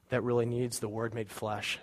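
ordinary talk

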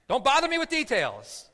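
a high, loud mock brush-off; exasperated shout. Abraham to his nephew about the promise: loud, high, hammed-up dismissal. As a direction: wave him off with a hammed-up bark.

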